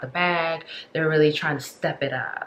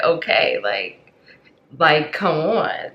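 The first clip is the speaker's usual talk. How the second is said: impatient come on; 7 dB louder, slower than usual. Pretend. Spirit realm voiced, impatient.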